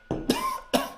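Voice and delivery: hacking choking cough. Acted. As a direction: cough like something went down wrong. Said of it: "went down the wrong hole".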